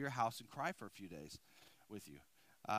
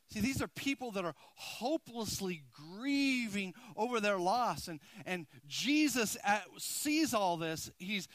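louder, slower, impassioned preaching; fervent preaching; 6 semitones higher, 8 dB louder, slower than usual. Acted. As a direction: build it up, pleading with the church. Preaching at full force.

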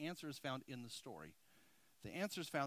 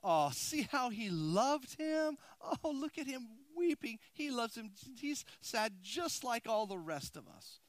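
higher, slow, mock-sympathetic onlooker; mourners sighing; 8 semitones higher, 4 dB louder, slower than usual. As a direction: coo it like a pitying bystander.

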